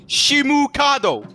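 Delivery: high, slow Japanese-accented "shimu kado"; "shimu kado" with exaggerated Japanese syllables. Shown as a demonstration. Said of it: "say it in the Japanese accent".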